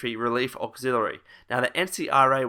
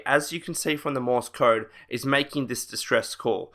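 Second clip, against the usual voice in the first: ordinary talk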